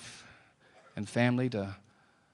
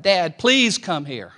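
yelled out; 6 semitones higher, 10 dB louder, slower than usual. Retold or imitated. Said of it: "he yelled out".

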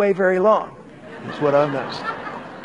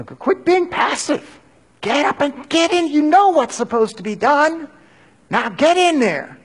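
urging himself; 9 semitones higher than usual. Pretend. Self-exhortation in inner voice.

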